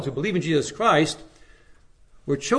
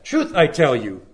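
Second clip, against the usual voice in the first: "Truth" hit firmly and louder, rest following; stress on truth; 5 dB louder than usual. Shown as a demonstration. Preacher repeating Paul's emphatic Truth, firm and louder. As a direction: put the weight on "Truth" and let "I tell you" fall. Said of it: "that's why Paul said".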